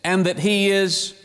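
sermon climbing toward fervor; building preacher fervor. Acted. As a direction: lift into full preaching. Rising preaching passage.

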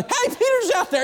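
very high squeal of excitement; Rhoda, so excited she leaves Peter outside, squealing the news; excited girl. Acted. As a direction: burst out sky-high with the news. Rhoda runs back excited.

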